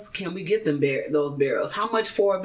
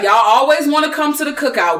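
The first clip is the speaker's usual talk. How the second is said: raised, louder playful call-out; playful mock complaint, pitched up; 7 semitones higher, 7 dB louder than usual. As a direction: tease them loudly in a higher voice. Voicing hosts addressing would-be guests.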